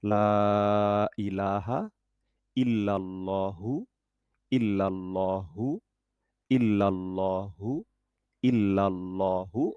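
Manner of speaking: slow drawn-out recitation demo. Shown as a demonstration. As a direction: recite each word slowly for learners. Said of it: "উচ্চারণটা পড়ে গেলেই হবে". (ধীরে ধীরে উচ্চারণ)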